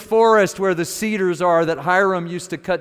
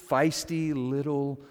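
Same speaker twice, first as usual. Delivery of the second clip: ordinary delivery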